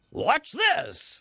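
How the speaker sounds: high, drawn-out showman's cry; television voice. Shown as a demonstration. Presenter showing off an eel trick on television, in a high showy TV voice. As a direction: sell it to the TV audience. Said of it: "You have to talk like that on television".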